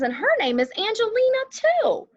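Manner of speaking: teacher voicing a boy classmate, high and eager; Rigoberto's line. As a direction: lift into an eager child's voice. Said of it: "Rigoberto says".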